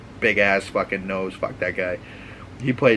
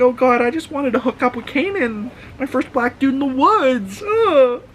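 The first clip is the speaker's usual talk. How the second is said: much higher, whiny put-on girl voice; mock whiny girl; 14 semitones higher, 3 dB louder than usual. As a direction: whine it like a ditzy girl regretting the hookup. Mocking girl's regret, laughing.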